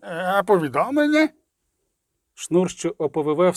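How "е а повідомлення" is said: higher, quick, hesitant servant's question; tongue-tied lackey. A narrator's reading. Fake lackey asks for the message, tongue-tied. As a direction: dim valet asking, with a rising squeak. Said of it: "у роль недорікуватого лакея".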